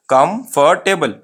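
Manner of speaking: "कंफर्टेबल" slowly as kum-far-TAY-bul; full "far" and "table" in "कंफर्टेबल"; wrong pronunciation. Shown as a demonstration. Teacher shows the students' mistake, slow full-syllable "कंफर्टेबल". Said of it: "ये wrong pronunciation है".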